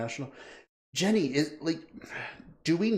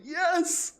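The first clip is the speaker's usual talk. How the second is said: much higher excited "Yes!"; cheer. Real, not acted. Streamer cheers a viewer's remark, high and excited. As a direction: celebrate the comment.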